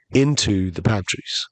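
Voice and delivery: ordinary delivery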